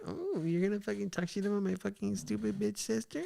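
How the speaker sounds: tiny, high, hushed mock-girl voice; put-on soft, high teasing voice; imagined coy girlfriend. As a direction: imitate her cooing, very softly and high. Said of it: "she's gonna sit there like that".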